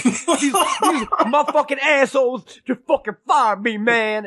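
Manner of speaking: imitated fired friend, high and drawn out; mock outrage with a laugh in it, Pryor-style; Jesse. Friend venting about being fired, comic indignant rant. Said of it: "he sounded like Richard Pryor".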